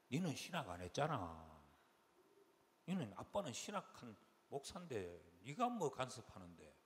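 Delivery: low-pitched, composed reproof; composed elder daughter. Retold or imitated. Elder daughter defending dad to a meddler, composed. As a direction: imitate a dignified daughter telling someone off. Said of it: "이렇게 점잖하게 이렇게 말을 해요".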